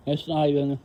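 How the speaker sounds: "It's not even" slurred around gum in mouth; "It's not even" mumbled, words blurred by gum; chewing gum. Real, not acted. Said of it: "my gum, sorry".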